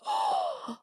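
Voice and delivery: a sudden, very high intake of breath; shocked high gasp at the sight of the bridge; sharp gasp. Retold or imitated. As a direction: gasp as the bridge comes into view. Sees the huge bridge ahead.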